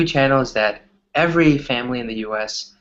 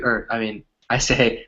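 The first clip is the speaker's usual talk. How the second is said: normal talking voice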